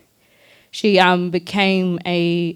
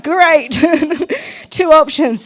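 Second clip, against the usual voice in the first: high, loud mock cheer; sarcastic; 8 semitones higher, 5 dB louder, slower than usual. Mocking a teacher's grim choice with high sarcastic cheer. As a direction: sell the fake delight, then laugh through it.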